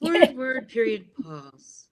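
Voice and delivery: "Word, word" high and loud, "period, pause" dropping slowly; script-reader rhythm, strong start on "Word", slow fall to "pause"; trained broadcast cadence. Shown as a demonstration. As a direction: punch "Word, word", then draw "pause" out falling. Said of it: "people tend to speak in those cadences when they're trained".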